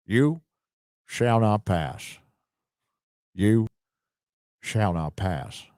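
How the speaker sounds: a lightly voiced deep Gandalf, slow and grave; Morgan Freeman as Gandalf. Reading a superchat, a slow mild Gandalf voice. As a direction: Morgan Freeman doing Gandalf, barely put on. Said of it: "I can do a much better Morgan Freeman impression".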